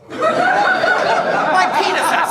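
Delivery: high, loud, outraged disbelief; incredulous repeat. Acted. Son quoting dad's greeting to audience, incredulous outburst. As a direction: repeat it as if unable to believe it.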